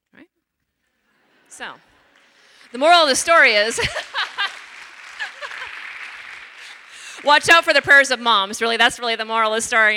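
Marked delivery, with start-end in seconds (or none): 2.7-3.8 much louder, higher build to the moral; big punchline. Real, not acted. Punchline of the story. 3.8-7.2 high breathless burst of laughter; big laugh. Real, not acted. Laughs at own punchline. 7.2-8.8 much louder, higher, still laughing; laughing punchline. Real, not acted. 8.8-10.0 louder, still buoyant from laughing; amused emphasis. Real, not acted. Restates punchline.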